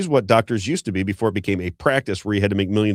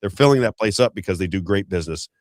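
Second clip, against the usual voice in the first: ordinary talk, nothing marked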